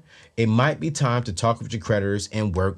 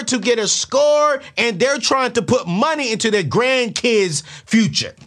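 higher, louder fiery sermon voice; impassioned preaching; 10 semitones higher, 4 dB louder than usual. Real, not acted. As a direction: preach it to the congregation. Passionate rant about creditors.

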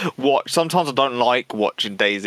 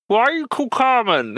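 high puppet voice; 11 semitones higher than usual. Retold or imitated. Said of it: "is this one's like".

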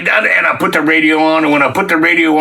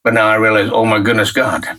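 awed prayer; 7 semitones lower than usual. Retold or imitated. Realizing why God moved him there, hushed and low.

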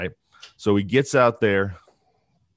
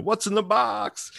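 much higher, strained cry of the famous line; desperate movie quote; 13 semitones higher than usual. Retold or imitated. Host quoting Seven about the stolen box, high strained cry. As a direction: squeal it like the frantic detective in Seven. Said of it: "like the movie Seven".

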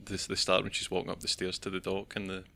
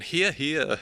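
mock cheer; 8 semitones higher, 9 dB louder than usual. Mimicking the newsroom staff.

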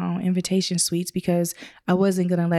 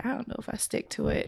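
softer whisper confession; whispered aside; 4 semitones higher, 8 dB quieter than usual. Real, not acted. Whispering to the hosts about the budget. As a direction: let them in on a secret.

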